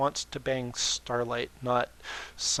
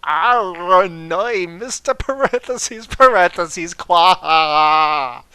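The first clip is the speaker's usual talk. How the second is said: loud, high exasperated groan; mock-anguished loud outburst; 5 semitones higher, 13 dB louder, much slower than usual. Real, not acted. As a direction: cry out in dismay at the text. Exasperated at parentheses.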